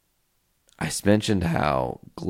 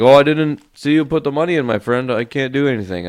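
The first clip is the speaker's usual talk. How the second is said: higher, louder, fast put-on voice; imitating the attendant; 7 semitones higher, 7 dB louder, faster than usual. Attendant brushing off the angry customer, mock put-on voice. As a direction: play the dismissive attendant shrugging it off. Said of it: "And the guy's like".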